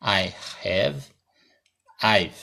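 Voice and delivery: I have and I've, each said slowly and carefully apart. Shown as a demonstration. Teacher answering a student's question, slow and careful. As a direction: spell out the contraction slowly for the class. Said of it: "Vou pronunciar lentamente".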